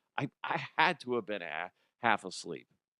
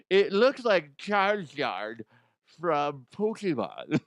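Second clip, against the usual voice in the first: lightly voiced slow, a bit higher seller; mock seller pitch; 4 semitones higher, 3 dB louder, slower than usual. Mocking people pitching a Cheeto, a lightly voiced slow seller. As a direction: ham up a slow sales pitch, only slightly.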